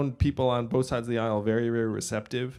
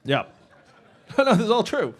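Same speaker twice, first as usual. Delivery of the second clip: ordinary talk, nothing marked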